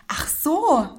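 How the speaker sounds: lightly voiced high provocative "Ach so". Snapping back at the rude colleague with a provoking "Ach so". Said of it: "richtig provokant gesagt".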